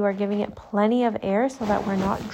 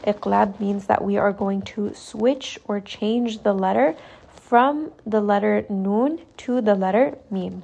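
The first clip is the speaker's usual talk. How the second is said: ordinary talk, nothing marked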